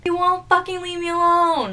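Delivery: high, loud exasperated outburst; retold yell. Girlfriend to boyfriend in the apartment lobby, retold yell. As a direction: act out yelling at a clingy ex. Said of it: "yelling at him".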